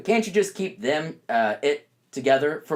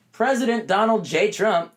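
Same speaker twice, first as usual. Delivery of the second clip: President said a little higher, leaning on it; stressed president; 6 semitones higher than usual. Shown as a demonstration. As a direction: lean on the word President. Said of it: "I really want to stress that word president".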